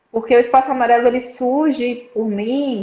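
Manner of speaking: ordinary talk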